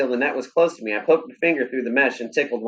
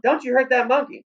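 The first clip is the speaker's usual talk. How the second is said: much higher, scolding call; urgent yell; 13 semitones higher than usual. A narrator's reading. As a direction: yell to stop the dog from hurting it. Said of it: "I yelled".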